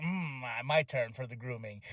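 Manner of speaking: low, soft, menacing mock Kill Switch murmur; low and quiet like a creepy monster; Kill Switch impression. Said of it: "Kill Switch is like".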